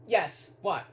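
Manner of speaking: usual speaking voice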